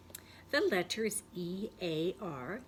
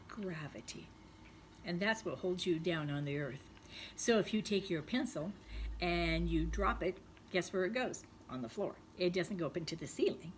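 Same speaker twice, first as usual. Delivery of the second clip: normal talking voice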